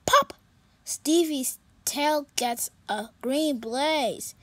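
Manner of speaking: ordinary delivery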